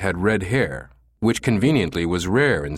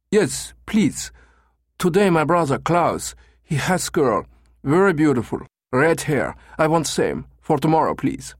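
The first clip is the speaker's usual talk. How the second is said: high German tourist in broken English; thick German accent; 7 semitones higher than usual. A narrator's reading. Caller requesting brother's red-haired girl, thick German accent. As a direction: play the foreigner with a heavy German accent. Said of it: "with a thick German accent".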